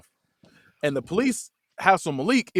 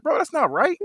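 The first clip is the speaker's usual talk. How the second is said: higher plaintive complaint; mock whiny protest; 12 semitones higher than usual. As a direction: complain like a wounded kid. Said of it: "He's like".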